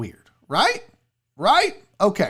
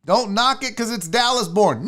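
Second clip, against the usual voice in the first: usual speaking voice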